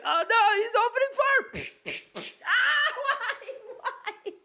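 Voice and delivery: high, hammed-up battlefield screaming; horrified scream. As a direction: shriek in mock horror, breaking into wailing. Acted colleague under fire.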